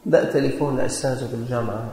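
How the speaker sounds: ordinary delivery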